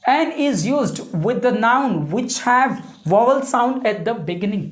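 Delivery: reading the rule out word by word, very slowly; teacher dictating a grammar rule at a very slow pace. Shown as a demonstration. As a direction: dictate it slowly so students can copy. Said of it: "reads out the grammar rule".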